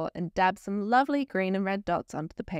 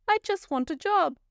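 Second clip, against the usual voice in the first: pleading bear; 10 semitones higher, 3 dB louder than usual. A narrator's reading.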